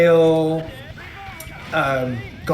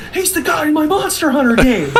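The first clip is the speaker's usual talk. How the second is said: excited recognition; 10 semitones higher, 3 dB louder than usual. Retold or imitated.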